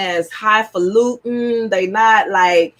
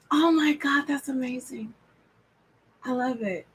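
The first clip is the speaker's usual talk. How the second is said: breathless whisper; 9 dB quieter than usual. Real, not acted. Reacting to viewer's happy news.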